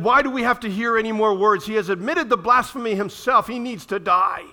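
loud, furious demand for death; enraged Pharisees. Retold or imitated. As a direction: shout the council's outrage. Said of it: "the rage as they tore their robes".

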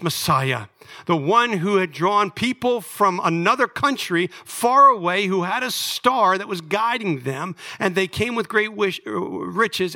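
high, loud pulpit proclamation; impassioned preaching. Real, not acted. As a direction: drive each phrase home to the congregation, high-pitched. Preaches with rising force.

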